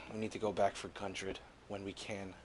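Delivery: a hint of a grieving soldier; quiet grief. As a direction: say it softly over a fallen friend. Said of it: "he kinda goes".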